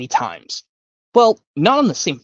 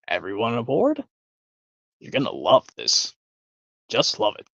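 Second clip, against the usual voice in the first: lower, murmured showman aside; Wonka aside; 3 semitones lower, 4 dB quieter than usual. Retold or imitated. Wonka murmuring to himself as guests board, low and hushed. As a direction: drop into a low private murmur. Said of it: "from Willy Wonka".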